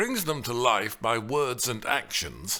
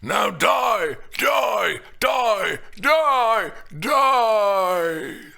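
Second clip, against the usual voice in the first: loud, high mock death cries; comic overacted dying yells; hammed-up dying shout; 10 semitones higher, 8 dB louder, much slower than usual. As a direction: shout each die like a bad actor stabbing himself. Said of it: "stabs himself with the words".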